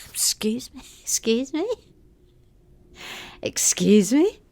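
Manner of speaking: incredulous question with rising end; mock affront. Host accused of sounding Australian, mock affronted disbelief. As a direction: act offended, each repeat rising in disbelief. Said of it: "I'm borderline outraged".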